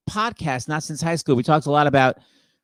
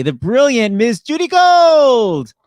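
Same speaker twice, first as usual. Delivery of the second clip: big, loud, stretched-out show intro; announcer introduction; 6 semitones higher, 6 dB louder, much slower than usual. Real, not acted. Host brings on a comedian, rousing announcer introduction. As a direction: draw out the name, high and loud.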